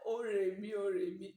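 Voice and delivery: loud, high "Ó rè mí" in Akure tones; Akure intonation. Retold or imitated. Repeating mother-in-law's praise, loud Akure dialect "ó rè mí". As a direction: call out "ó rè mí" with the dialect lilt. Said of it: "forgive my intonation".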